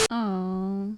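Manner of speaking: quiet, high cooing; soft cooing. Real, not acted. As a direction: coo softly, adoring. Cooing softly over someone.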